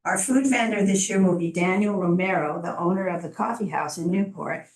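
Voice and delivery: usual speaking voice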